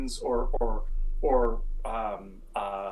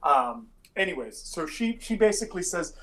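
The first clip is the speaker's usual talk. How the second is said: ordinary talk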